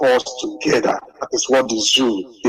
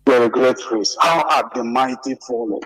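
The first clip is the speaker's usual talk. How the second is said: ordinary delivery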